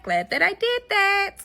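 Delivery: smug sing-song echo; pleased with herself, drawn out high. Shown as a demonstration. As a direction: stretch it out up high, savoring it.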